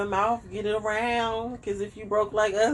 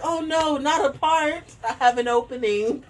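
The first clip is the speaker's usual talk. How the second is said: lightly voiced chirpy therapist, higher; bright professional reassurance, pitched up; 7 semitones higher than usual. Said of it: "She'll be like".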